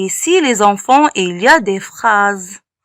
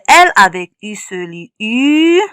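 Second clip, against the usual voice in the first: ordinary talk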